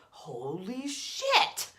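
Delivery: a touch of slow stunned awe, rising; amazed. Pretend. You as things align, mildly amazed. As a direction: let the wonder hit you. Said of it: "you just go".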